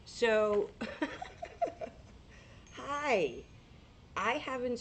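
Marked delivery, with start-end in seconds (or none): 0.7-2.7 airy laugh, high and voiceless; breathy high laugh. Real, not acted. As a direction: laugh it off before the greeting. Laughs while saying hi.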